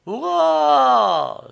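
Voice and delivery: long, high, loud hurrah, falling away; enthusiastic cheer. A narrator's reading. Excited auction crowd hails the huge bid, roaring cheer. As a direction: cheer at full voice like a whole crowd. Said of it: "s'écria la salle enthousiasmée".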